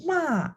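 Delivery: "ma" high and falling; falling fourth tone. Shown as a demonstration. Teacher explaining the word for scold, falling "ma". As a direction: throw "ma" downward from high.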